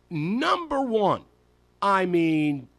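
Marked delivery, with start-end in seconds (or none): 0.1-1.2 number one, drawn out slowly; slow buildup. Real, not acted. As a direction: stretch out number one for suspense.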